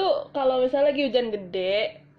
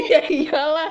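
laughing; 3 semitones higher, 5 dB louder, slower than usual. Real, not acted. Laughing after being scolded.

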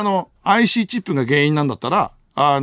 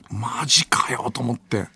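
slow unvoiced whisper to himself; whispered thought; 5 semitones lower, 5 dB quieter, slower than usual. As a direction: whisper it in disbelief. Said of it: "inner thought whispered". (小声でつぶやく)

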